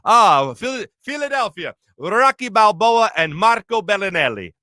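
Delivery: hammed-up Italian accent; Italian pizza man. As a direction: imitate a delighted Italian shopkeeper greeting Philadelphians. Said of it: "And the guy goes".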